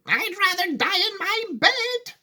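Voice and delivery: put-on high, slow quavering old lady; Grandma Josephine refusing the lift, high and slow; shouted. A narrator's reading. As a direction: cry it out stubbornly, hammed-up. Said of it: "shouted Grandma Josephine".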